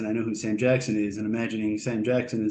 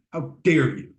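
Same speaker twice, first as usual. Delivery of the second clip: mock outrage; slower than usual. Shown as a demonstration.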